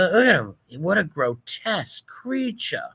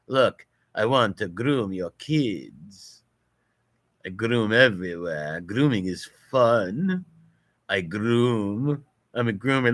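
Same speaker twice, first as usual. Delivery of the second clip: mocking low voice; 6 semitones lower than usual. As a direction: drop it down into a leering caricature. Voicing a protester mockingly.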